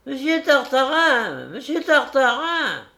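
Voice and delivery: put-on high, cracked old fairy calling out. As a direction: call him like a hoarse old fairy. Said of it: "une voix de vieille fée, enrouée, cassée, fêlée".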